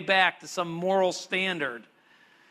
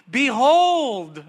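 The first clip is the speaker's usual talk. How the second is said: Behold ringing out, much higher and louder; proclaimed Behold; 6 semitones higher, 8 dB louder than usual. Acted. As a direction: cry out Behold like a herald. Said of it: "that great Hebrew exclamation".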